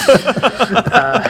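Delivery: high burst of laughter; laughing. Real, not acted. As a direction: break up laughing. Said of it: "laughing at the lisp joke".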